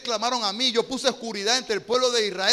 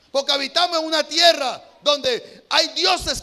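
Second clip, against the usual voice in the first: louder, higher preaching voice building to a climax; fiery preaching; 5 semitones higher, 9 dB louder than usual. Acted. Preacher urges the congregation to choose God, fiery preaching. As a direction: drive the call to decide home.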